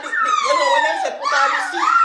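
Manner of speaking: very high, fast, laughing squeal of a voice; mock squeaky announcement. Mimicking the airplane toilet announcement to his friend, in a laughing high squeal. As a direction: squeak it out like a silly cabin chime.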